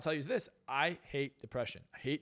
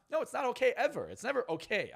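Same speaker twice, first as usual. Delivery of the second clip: higher, louder burst of refusal; emphatic rebuttal; 9 semitones higher, 4 dB louder than usual. Acted. Man rebutting his own excuse for a treat, emphatic. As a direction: shoot the excuse down, pitch jumping up.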